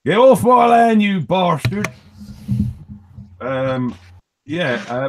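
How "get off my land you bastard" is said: mock farmer yell. Mock farmer shouting at intruders.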